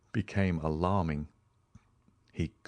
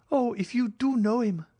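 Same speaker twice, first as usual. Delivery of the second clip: put-on high, urgent cry of a young woman; 4 dB louder than usual. Julie cries out to the count, high and urgent. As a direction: cry out in desperate hope. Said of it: "cried Julie".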